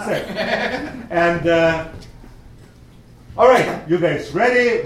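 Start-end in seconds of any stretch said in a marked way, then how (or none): 3.4-4.8 lightly acted teacher, raised and louder; rallying teacher. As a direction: call the class to attention. Teacher rallies the new class.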